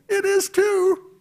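high, drawn-out insistent retort; emphatic retort. Acted. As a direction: insist it's right there, slow and high. Retorting to a skeptic.

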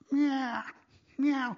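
high cartoon cat meow; a silly high meow; mock meowing. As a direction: meow like a dog playing cat. Dog pretending to be cat.

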